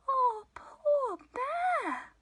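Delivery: high, slow, drawn-out sympathy; pitying. A narrator's reading. Storyteller pities blubbering Bear, high and sympathetic. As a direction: coo it with storybook pity.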